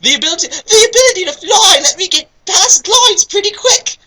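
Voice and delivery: very high, loud jester voice with a laugh; jester Night Marion. Acted. Reader voicing the jester as he brags, high and giddy. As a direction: play a cackling court jester. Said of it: "said the jester like Night Marion".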